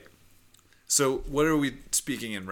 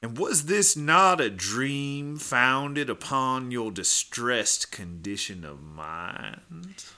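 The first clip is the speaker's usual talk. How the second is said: slow, loud, theatrical lawyer; courtroom prosecutor; 6 dB louder, much slower than usual. Acted. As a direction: grill her like an old-time prosecutor. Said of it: "[name] is gonna be the prosecutor".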